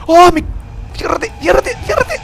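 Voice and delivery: high frightened shrieking plea; terrified squeal to hide; panicked cry. Real, not acted. Escondido, el monstruo llega. (grito de pánico real)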